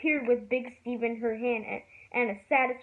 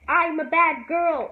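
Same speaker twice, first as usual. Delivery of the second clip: interrupting; 5 semitones higher, 8 dB louder, slower than usual. A narrator's reading. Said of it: "interrupted Ramona".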